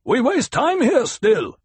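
high, loud, urgent call; calling from above. A narrator's reading. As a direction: call down impatiently from the rocks. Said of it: "The voice from the rocks above them called".

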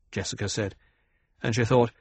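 ordinary delivery

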